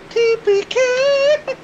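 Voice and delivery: high, slow, spelled-out doom; drawn-out dread. Real, not acted. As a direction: stretch out each letter in mock horror. Dreading a total party kill.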